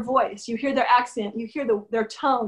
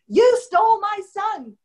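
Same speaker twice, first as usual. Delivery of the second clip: slow, much higher, louder accusation; wounded husband's accusation; 10 semitones higher, 5 dB louder, slower than usual. Acted. Husband throws the blame back at his wife, slow and loud. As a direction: lay the blame slowly, wounded. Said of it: "And he said".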